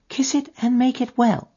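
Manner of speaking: put-on high child's voice, perfectly serious; a small child earnestly asking for a kiss; grave little girl. Little girl asking the man to kiss her head, high and grave. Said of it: "with perfect gravity".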